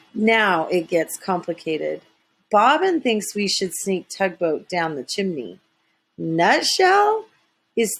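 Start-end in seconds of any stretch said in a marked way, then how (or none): none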